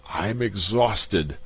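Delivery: slow, gasping for air; breathless from cranking the lever. Acted. Magnan at the lever, gasping and exhausted. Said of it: "Magnan gasped".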